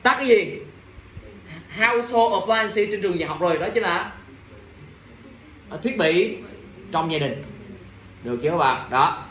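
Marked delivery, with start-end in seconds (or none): none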